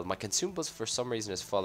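ordinary talk, nothing marked

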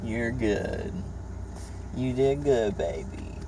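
soft, gentle reassurance; soothing. Real, not acted. Mother soothing child.

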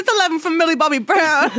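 high-pitched mock rapper introduction; hype intro. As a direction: announce the band like a pretend hype man. Mock hip-hop group introduction.

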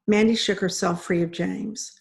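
ordinary delivery

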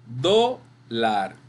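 "Dólar" broken in two, long DO, then lar; DO-lar, pulled apart for a class; split into syllables. Shown as a demonstration. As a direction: sound out "Dólar" as DO... lar, stressing DO. Teacher sounds out stressed syllable.

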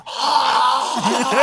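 long, high strangled cry; drawn-out acted wail; mock choking scream. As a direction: imitate a dragon crying out as it chokes. Acting out the choking dragon.

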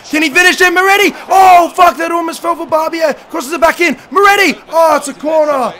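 rising yells as the chance builds; excited shouting commentary. Real, not acted. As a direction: call the attack loud and high. Live goal chance commentary.

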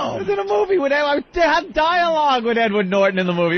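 high-pitched boastful protest; hammed-up wounded pride; mock indignation. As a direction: plead your credits in comic outrage. Acting out indignant actor.

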